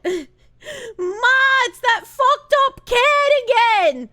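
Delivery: high, loud mock holler; yelling for Ma. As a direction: holler to Ma in another room. Acts yelling to mother about TV.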